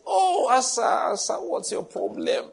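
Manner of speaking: a preacher voicing a prophet scolding King Asa; rebuking prophet. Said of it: "the prophet Hanani came and said".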